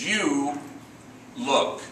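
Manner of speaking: light mock posh English accent; drawn-out, a hint of King's English. Homie praising the belt in light mock King's English. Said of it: "in perfect King's English".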